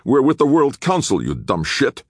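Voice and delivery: high, loud outburst that drops at the insult; snarling. A narrator's reading. Disarmed guard warns his captor, angry snarl. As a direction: snarl with outraged authority. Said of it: "the big redhead snarled".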